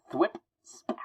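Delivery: whispered thwip and smack; web-shooter sound effects. Acted. Voicing comic sound effects.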